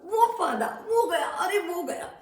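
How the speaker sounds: high, loud rush; thrilled chase narration; excited. A narrator's reading. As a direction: cheer him off. Monkey leaps away.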